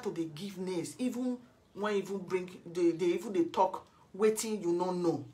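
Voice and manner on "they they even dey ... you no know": normal talking voice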